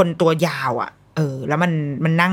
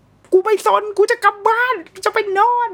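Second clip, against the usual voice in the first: very high mock-baby tantrum; wailing baby's voice; 4 dB louder than usual. Mother voicing a months-old baby who won't listen, wailing. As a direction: cry out the words like a protesting infant. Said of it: "เด็กตอนนั้นอายุประมาณ สามสี่ เดือน มันไม่รู้เรื่อง".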